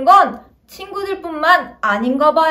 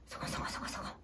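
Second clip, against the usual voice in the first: whisper; 24 dB quieter, much faster than usual. Acted. Friends whispering a plan.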